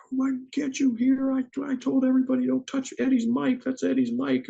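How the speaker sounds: high-pitched mock tirade; ballistic sound guy. Sound guy scolding over Eddie's mic, mock ballistic. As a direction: imitate an outraged roadie in a strained high voice. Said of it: "sound guy goes ballistic".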